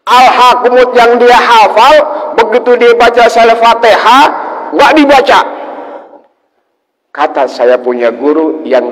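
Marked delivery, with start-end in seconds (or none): 0.1-5.4 very high, loud climactic telling; preaching at full force. Acted. Preacher delivers the punchline to the congregation, very high and loud. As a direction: hit the punchline hard for the crowd.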